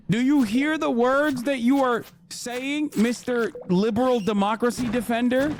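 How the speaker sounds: incredulous mockery. Real, not acted. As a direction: needle him with mock disbelief. Mocking the pundit, incredulous.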